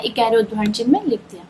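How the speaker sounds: quick whispered answer; teacher whispering the answer to her own question. Shown as a demonstration. Teacher repeating the same answer.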